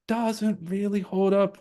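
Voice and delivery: mock voice. Mocking a claim.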